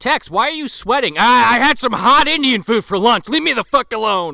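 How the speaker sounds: high, loud, flustered excuse; caught-out spy blustering loudly; nervous Tex. Acted. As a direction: sweat through a lame excuse. Acting out movie scene.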